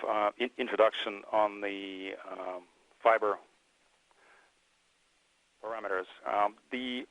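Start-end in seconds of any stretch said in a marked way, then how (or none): none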